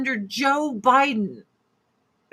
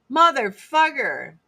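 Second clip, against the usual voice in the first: ordinary delivery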